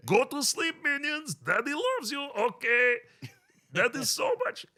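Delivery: put-on high, loud Gru voice for the kids; a dad playing Gru at bedtime. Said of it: "I do Gru for my kids".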